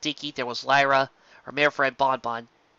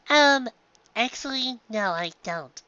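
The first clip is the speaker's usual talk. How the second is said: awkward hesitant; 8 semitones higher, slower than usual. A narrator's reading. Awkward admission.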